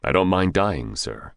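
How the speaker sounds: plain reading voice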